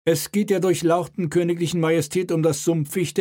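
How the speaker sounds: officer's voice. Acted. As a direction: lay out the case stiffly.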